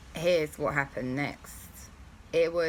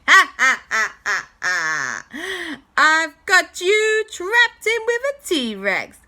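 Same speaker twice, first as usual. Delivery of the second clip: gloating villain; 9 semitones higher, 8 dB louder than usual. Acted. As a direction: ham up the baddie. Villain gloats at trapped heroes.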